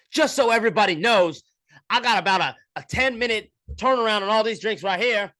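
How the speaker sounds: high, loud, flustered announcement to the line; frazzled barista girl. Retold or imitated. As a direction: play the overwhelmed barista warning customers. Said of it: "that was a reenactment of the girl".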